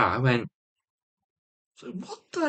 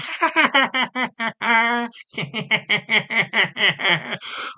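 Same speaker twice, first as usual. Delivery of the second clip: high, long staccato cackle; imitated cackle; 11 semitones higher than usual. Imitating the woman cackling behind him, mock cackle. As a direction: cackle away in rapid high bursts. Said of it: "She's cackling like".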